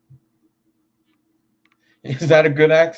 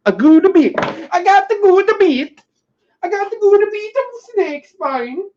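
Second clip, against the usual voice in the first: mock Italian accent; 4 dB louder than usual. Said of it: "I don't even know what accent".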